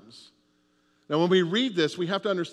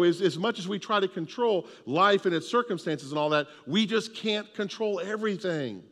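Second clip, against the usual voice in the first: ordinary delivery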